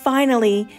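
usual speaking voice

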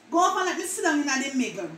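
quick patois push; Jamaican patois. Shown as a demonstration. Vlogger telling someone to move over, in patois. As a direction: hurry them over Jamaican style. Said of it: "words that Jamaican mispronounce".

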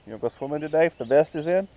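quick eager sing-song question; excited dog talk. Real, not acted. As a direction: hype the dog up about swimming. Asking the dog again, eagerly.

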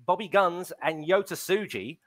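ordinary delivery

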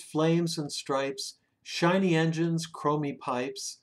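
ordinary delivery

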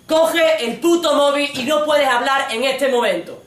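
loud, slow, high-pitched command; acted angry bellow; furious order. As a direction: shout it out word by word, exasperated. Boyfriend orders her to answer phone.

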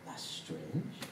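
quiet inner thought. Retold or imitated. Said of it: "And I was like".

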